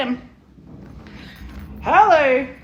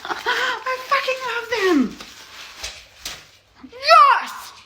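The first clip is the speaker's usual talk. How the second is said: thrilled squeal; 12 semitones higher, slower than usual. Real, not acted. Thrilled at unboxing.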